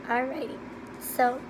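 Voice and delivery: normal talking voice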